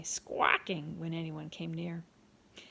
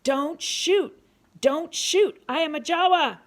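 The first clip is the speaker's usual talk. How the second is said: high, loud shouted plea; desperate yell; 9 semitones higher, 11 dB louder, slower than usual. A narrator's reading. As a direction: shout to be heard before arrows fly. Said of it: "yelled in the native Ajawa language".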